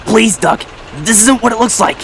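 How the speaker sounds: high-pitched anxious plea; pleading. A narrator's reading. Diesel to Duck while dangling, pleading. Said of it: "pleaded Diesel".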